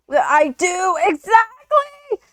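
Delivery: sudden high yelled exclamation, stretched slowly on the end; retold shout. Telling classmates how she reacted, a mock yell. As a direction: burst out in vindicated agreement.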